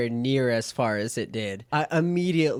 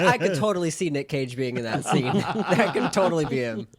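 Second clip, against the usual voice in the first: talking through laughter; laughing; faster than usual. Real, not acted. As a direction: laugh while saying it. Laughing through retold thought.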